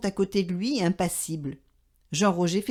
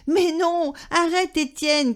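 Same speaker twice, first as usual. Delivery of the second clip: a touch higher, mock shout at the dog; Papa shouting; 7 semitones higher than usual. A narrator's reading. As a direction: scold the dog in a mild storybook shout. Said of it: "crie papa".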